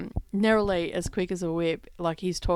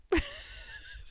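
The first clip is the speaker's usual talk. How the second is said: high airy laugh; breathy high laugh; 6 dB quieter than usual. Real, not acted. Mother laughing at husband's stubborn reply, airy and high.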